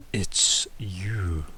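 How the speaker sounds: low whispered creature growl; growled warning. Acted. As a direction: breathe it out like a hidden beast. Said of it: "a very, very deep, growling voice that says".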